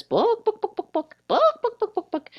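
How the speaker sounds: high squawky bawk bawk of a chicken; mock chicken clucking, book book. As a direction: cluck it out like a hen. Chicken in joke says book.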